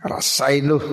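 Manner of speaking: lightly voiced scornful hum; a hint of gloating scorn; smug mock. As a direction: brush him off with a sneer. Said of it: "Nabi Musa cuma".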